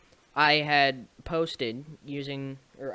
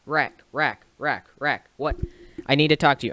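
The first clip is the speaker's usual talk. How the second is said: loud, urgent friend voice, words tumbling out; frantic friend imitation; 6 dB louder than usual. Imitating a frantic friend calling out his name. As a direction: play the panicked buddy who has to talk now. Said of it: "And he's like".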